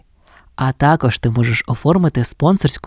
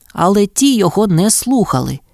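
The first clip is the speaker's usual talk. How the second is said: plain reading voice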